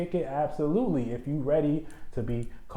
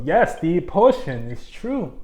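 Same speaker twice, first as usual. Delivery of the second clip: excited squeal; 9 semitones higher, 4 dB louder than usual. Real, not acted. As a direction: gush about the potion, giddy and high. Tipsy, hyped about the drink.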